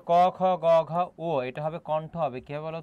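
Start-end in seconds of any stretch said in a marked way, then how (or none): 0.1-0.9 loud letter listing. Shown as a demonstration. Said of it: "দন্ত".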